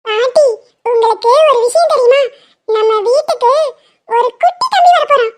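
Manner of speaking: put-on a small girl bursting with news; little girl voice. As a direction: daughter tells grandma a baby brother is coming, in a high child voice. Child voice telling grandma news.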